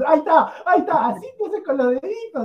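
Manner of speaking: gloating sing-song taunt; mocking Mosquera. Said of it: "Y Mosquera te dice".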